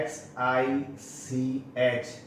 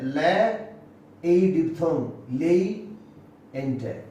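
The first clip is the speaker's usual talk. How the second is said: usual speaking voice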